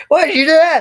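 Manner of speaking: very high, loud squawk; mock shocked yell. Everybody reacts in the joke, mock shocked yell. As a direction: shriek it in hammed-up alarm.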